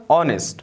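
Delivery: "honest" begun straight on the vowel, no H; silent H. Shown as a demonstration. English teacher showing learners the rule, "honest" with silent H. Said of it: "حروف H را تلفظ نمونیم".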